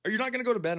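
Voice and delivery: a hint of a mother checking up on her son; imitating mom. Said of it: "She's like".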